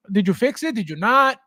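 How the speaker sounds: normal talking voice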